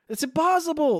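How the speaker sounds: "impossible" yelled high and loud; real disbelief, at full volume; exasperated shout. Real, not acted. To co-host about Mabel looking like Marge, shouting. Ranting about the episode's logic.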